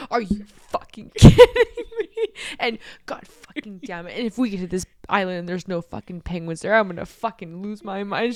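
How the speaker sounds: loud, laughing imitation of a meltdown; mock exasperated outburst; freaking out. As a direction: retell a furious rant, cracking up at it. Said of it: "she's just freaking out".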